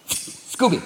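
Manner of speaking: quiet cat's call; scheming cat. Acted. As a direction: softly rouse the sleeping dog. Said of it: "the cat's like".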